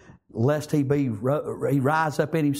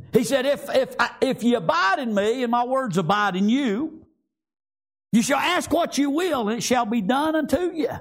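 raised, ringing pitch on scripture; impassioned preaching; 8 semitones higher than usual. Acted. Preacher quoting Jesus' promise to the congregation, impassioned. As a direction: preach the verse out high.

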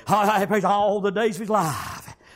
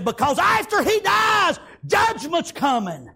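shouted warning; 9 semitones higher, slower than usual. Acted. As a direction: hit it like a prophet's alarm. Grandfather warning of coming judgment.

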